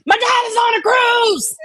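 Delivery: high mock yell of a caps message; mock shout. Streamer echoes the all-caps cruise message, high mock shouting. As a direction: yell it in capitals.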